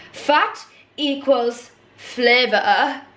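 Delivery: slow, drawn-out "Fat equals flavour" with "flavour" as "flivver"; teacher's catchphrase. Retold or imitated. Home ec teacher's favourite saying, slow mock delivery. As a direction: lightly mimic the old teacher's saying, very slowly. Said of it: "she would always say".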